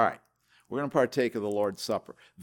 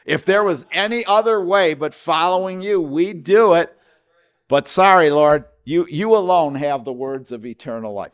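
voicing Peter; 5 semitones higher, 8 dB louder than usual. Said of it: "what Peter was saying".

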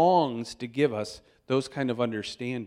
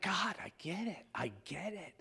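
hushed awe; 4 semitones higher, 13 dB quieter than usual. Retold or imitated. Quiet awe over morning coffee.

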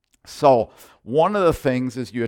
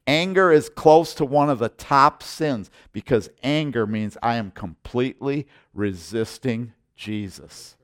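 normal talking voice

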